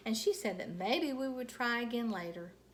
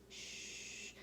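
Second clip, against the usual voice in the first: a soft shh; hushing; 12 dB quieter than usual. A narrator's reading. Mother hushing Junie B on the plane. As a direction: hush the child.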